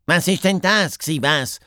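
high-pitched, insistent questioning; loud startled question. A narrator's reading. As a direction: ask it loudly, pitched well up. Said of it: "fragt der Doppelhas laut". (laut gfragt)

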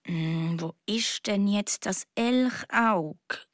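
slow, low, absent-minded murmur. Acted. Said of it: "murmelt der Luis".

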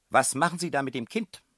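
high, loud accusing demand; brusque woman. A narrator's reading. Headmistress confronts strangers holding child, high sharp demand. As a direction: confront strangers without any greeting. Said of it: "fragte sie ohne Begrüßung".

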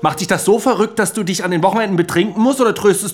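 mocking taunt.

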